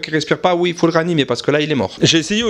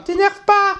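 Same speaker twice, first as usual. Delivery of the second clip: high-pitched mock pleading; mock high wife voice; nagging wife impression; 13 semitones higher, slower than usual. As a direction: squeal it like an imploring wife. Imitates wife again.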